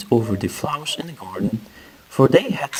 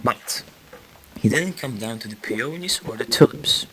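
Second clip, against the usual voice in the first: ordinary delivery, nothing marked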